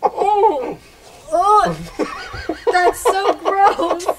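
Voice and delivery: high, loud, slow, laughing disgust; sprayed by a drink, laughing in disgust. Real, not acted. Disgusted after something spilled.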